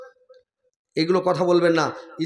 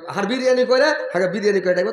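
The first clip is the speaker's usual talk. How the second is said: higher, louder call; 4 semitones higher, 4 dB louder, faster than usual. Retold or imitated. Wife calling husband by pet name, loud call. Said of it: "আর তুমি কইবে".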